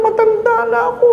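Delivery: very high whine, stress on ako; high elderly complaint; whiny old lady. Retold or imitated.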